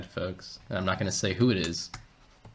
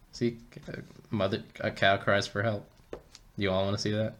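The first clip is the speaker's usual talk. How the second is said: usual speaking voice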